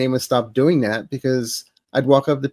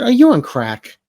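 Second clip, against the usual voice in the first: incredulous; 10 semitones higher, 3 dB louder than usual. Retold or imitated. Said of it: "I said".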